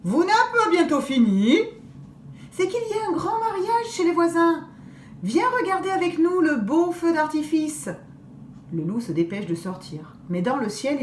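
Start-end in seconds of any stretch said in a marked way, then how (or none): 0.0-7.9 put-on girls calling. Girls lure the wolf.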